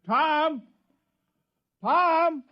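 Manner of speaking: very high, loud calls; loud calls to a hidden friend; shouting a name. Acted. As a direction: yell Tom's name across the field. Mayor shouts for hidden Tom.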